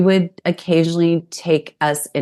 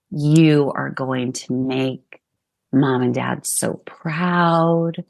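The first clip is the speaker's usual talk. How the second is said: slow, sincere words to her younger self; tender reassurance; slower than usual. Real, not acted. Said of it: "I would say to four-year-old [name]".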